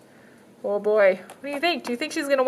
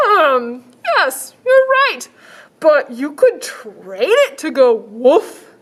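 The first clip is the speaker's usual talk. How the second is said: high, slow salesman pitch; shelter man voice; 4 semitones higher, 6 dB louder, slower than usual. Acted. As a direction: sell it like an eager shopkeeper.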